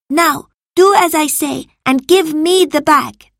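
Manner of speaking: high, loud, insistent order; angry command. A narrator's reading. Marcus to disobedient Salvius, angry command. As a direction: demand obedience angrily. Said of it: "He looked very angry".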